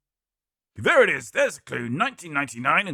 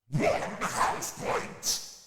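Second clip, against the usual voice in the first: whisper; 4 dB quieter, much slower than usual. Acted. Whispered aside about stakes.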